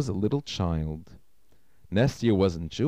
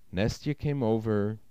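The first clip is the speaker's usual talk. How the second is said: plain reading voice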